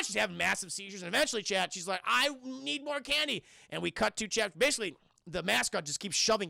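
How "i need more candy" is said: slow, high mock craving; crazed candy craving. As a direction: draw it out like a candy-crazed kid. Imitating crazed, candy-high Mabel.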